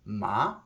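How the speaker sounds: "ma" with its own Mandarin tone; Chinese tone. Shown as a demonstration. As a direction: say "ma" as a carefully toned syllable. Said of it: "the Chinese language is very much tonal".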